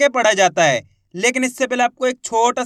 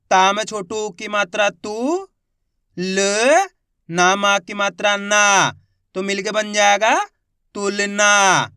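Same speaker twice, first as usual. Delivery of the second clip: slow sounding out; slower than usual. Shown as a demonstration. Teacher sounding out a word for beginner readers, slowly. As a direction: drag each syllable, then land the word. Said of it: "teacher sounds out word".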